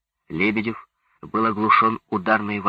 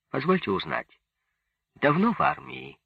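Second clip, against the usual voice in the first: polite, higher-pitched inquiry; 6 semitones higher than usual. A narrator's reading. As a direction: ask courteously, lifting the pitch. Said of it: "вежливо спросил Лебедев".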